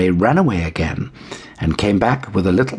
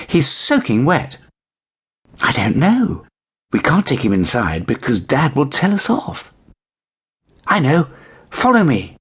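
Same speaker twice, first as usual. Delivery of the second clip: put-on higher young girl voices; little girls; 7 semitones higher than usual. A narrator's reading. Two girls deciding how to hide the kitten. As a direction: sound like eager children.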